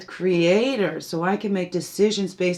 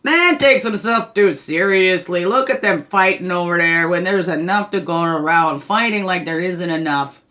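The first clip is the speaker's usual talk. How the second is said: laughing spirit; 5 dB louder than usual. Acted. Healer voices spirit laughing at humans fighting, amused and teasing. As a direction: start on a laugh, then marvel loudly at them. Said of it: "there might be spirit laughing, going".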